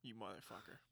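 low, very soft swearing; quiet cursing. Retold or imitated. Employee watching customer sprint out, low quiet curse. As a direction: curse under your breath.

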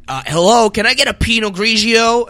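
loud, high mock call for service; impatient customer. Lady ordering wine during the commotion, loud high calling.

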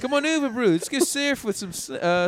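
high mock Australian accent. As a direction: play an Aussie surfer voice. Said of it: "That's how they kinda sound like".

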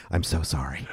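low, soft retold apology; hushed apology. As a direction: mutter an embarrassed apology under your breath. Repeated apology to the wait staff.

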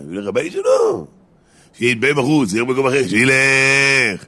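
a mounting shout sending people off, final word stretched out; heated dismissal. Retold or imitated. Telling impatient listeners to go elsewhere, heated, drawn-out shout.

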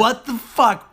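loud, high outburst; shocked. Real, not acted. Friend hears the boy took another kid's turkey, loud and high. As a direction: blurt it out in shock.

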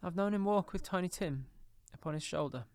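high mother's voice, bravely cheerful; steady, cheerful. A narrator's reading. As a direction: keep it high and cheerful, with a slight falter. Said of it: "cheerful voice that only faltered once".

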